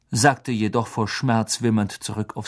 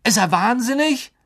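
high, loud astonished question; shocked outburst; 13 semitones higher, 4 dB louder than usual. Acted. Boy exclaims in shock about the headmaster, high and loud. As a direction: gasp it out, wide-eyed. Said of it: "mit aufgerissenen Augen".

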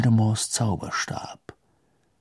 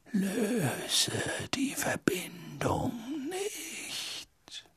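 very slow, airy whisper; whisper in the ear; 13 semitones higher, 14 dB quieter, much slower than usual. A narrator's reading. Phoenix song urges Harry to hold on, slowly whispered. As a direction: whisper it like a friend at the ear, drawn out. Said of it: "als würde ein Freund ihm ins Ohr sprechen".

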